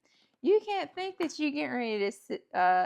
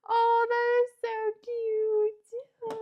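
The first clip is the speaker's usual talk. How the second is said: high, slow gush over something cute; much higher, slower delighted gush; delighted squeal; 11 semitones higher, 7 dB louder, slower than usual. Real, not acted. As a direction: coo at it like a tiny puppy. Gasping at the finished door.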